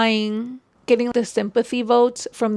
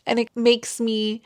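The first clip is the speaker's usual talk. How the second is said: usual speaking voice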